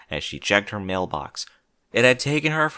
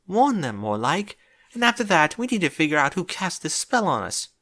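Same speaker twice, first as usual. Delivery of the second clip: worried Rarity; 7 semitones higher than usual. Acted. Rarity worried about the spell.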